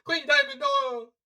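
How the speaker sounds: high, loud wail through laughter; mock wailing. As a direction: cry out in mock anguish, laughing. Mock wail while laughing at scene.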